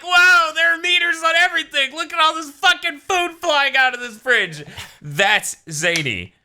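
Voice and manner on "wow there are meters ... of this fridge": much higher, louder amazed gushing. Retold or imitated. Host voices the kids amazed in the hotel room, high gushing. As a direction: gawk at everything in wonder. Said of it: "they're like".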